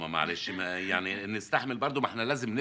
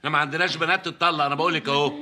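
loud, higher, fast scolding; angry scolding; 6 semitones higher, 6 dB louder than usual. Acted. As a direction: tell her off hard, no arguing. Brother scolds sister over divorce. (أخ يوبخ أخته على الطلاق بصوت عالٍ)

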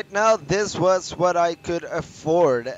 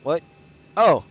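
ordinary talk, nothing marked

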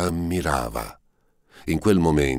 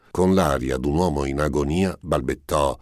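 ordinary delivery

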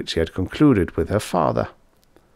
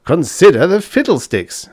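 louder, scornful outburst, hitting the; angry retort; 9 semitones higher, 6 dB louder than usual. Acted. Drunk student mocking his professor's appeal, angry and loud. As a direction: throw his words back at him. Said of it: "the student cried angrily".

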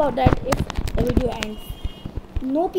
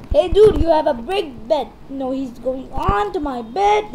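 ordinary talk, nothing marked

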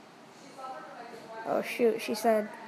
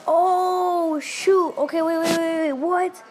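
higher and louder, sudden alarmed surprise; real startled outburst; 6 semitones higher, 5 dB louder, slower than usual. Real, not acted. Vlogger shocked by the story's twist, alarmed and loud. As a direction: jolt up in real alarm and stop everything.

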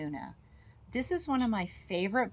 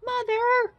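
much higher, tiny squeaking cry; squeaky baby bat; 9 dB louder than usual. Acted. Lost baby bat calling for her mother, squeaky. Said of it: "Stellaluna squeaked".

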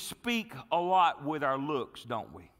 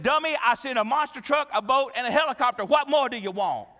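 loud, high, mock-exasperated rebuke; exasperated scolding; 6 semitones higher, 7 dB louder than usual. God answering the drowned man, loudly exasperated. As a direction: pile up the list in comic exasperation.

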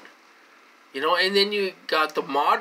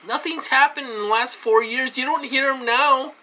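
louder and higher, venting anger; loud rant; 7 semitones higher, 6 dB louder than usual. Real, not acted. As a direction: raise your voice, ranting. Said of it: "get on a rant and get loud".

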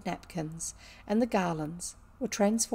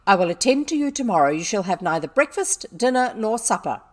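loud, stern sentence; angry punishment; 2 semitones higher, 9 dB louder than usual. A narrator's reading. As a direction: lay down the punishment forcefully. Headmistress sentences Sarah to punishment.

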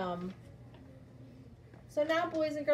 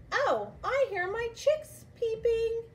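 excited; 10 semitones higher, 4 dB louder than usual. Acted. Teacher acts excited for kids.

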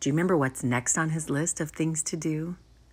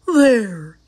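loud, higher, finished-with-it Toad; Toad, satisfied; 4 semitones higher, 9 dB louder than usual. A narrator's reading. Toad crossing out his last item, lightly voiced satisfaction. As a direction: declare it loudly, satisfied. Reads Toad's line in story.

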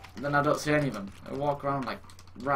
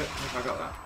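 normal talking voice

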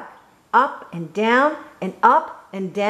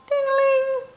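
very high ringing ding-a-ling; bell imitation. A narrator's reading. Narrator sounding Froggy's new bell, high ringing. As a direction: sing it out like a little bike bell.

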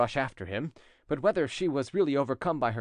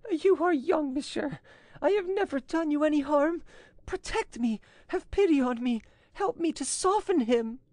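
high, pleading woman's voice; imploring appeal. A narrator's reading. Woman on her knees appeals to the chevalier, imploring. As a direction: appeal for pity, pitched very high. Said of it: "appealing to Chevalier de Moranges".

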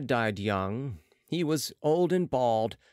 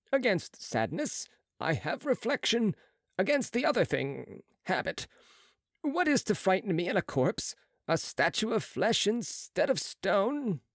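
higher, brisk surgeon's voice; a proud young surgeon, pitched up; Marat's voice; 8 semitones higher than usual. A narrator's reading.

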